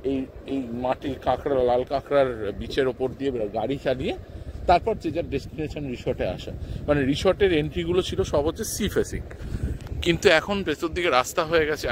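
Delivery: ordinary delivery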